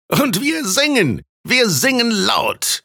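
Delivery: put-on high, slow drunken drawl; drunken slurring. A narrator's reading. Red-haired reveller boasting of singing, tipsy high slur. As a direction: drag the words out high, like a drunk. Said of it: "lallte der Rothaarige".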